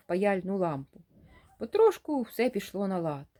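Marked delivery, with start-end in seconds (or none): none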